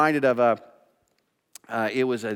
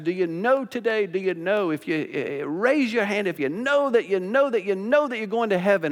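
lightly imitated country preacher, rising and insistent; a touch of revival-meeting preacher; 8 semitones higher, 4 dB louder than usual. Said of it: "kind of a country preacher".

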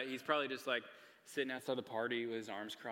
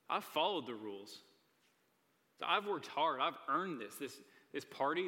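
resentful son; 3 semitones higher than usual. Retold or imitated. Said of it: "directly disrespects his father".

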